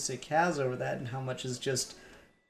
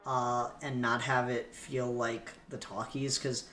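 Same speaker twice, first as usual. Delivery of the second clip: ordinary delivery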